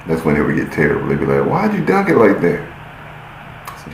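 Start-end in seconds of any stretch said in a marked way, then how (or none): 1.5-2.6 high mock taunting voice; mocking friends. Friends taunting him on the court, high mock voice. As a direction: squeak out the teasing question.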